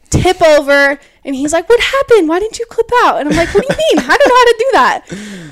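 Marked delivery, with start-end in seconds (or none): 1.7-3.2 high-pitched, alarmed quoting of brother; mock startled questioning. Brother to fallen sister who didn't clip out, startled. He questions her after she tipped over. 3.5-5.5 high, loud mock protest; exasperated retold protest. Fallen beginner answering brother about clipping out, exasperated. She protests after falling over.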